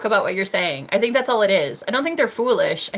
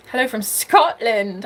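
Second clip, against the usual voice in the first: ordinary delivery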